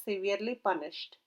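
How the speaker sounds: plain reading voice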